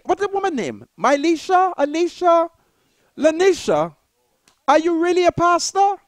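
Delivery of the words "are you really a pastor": ordinary delivery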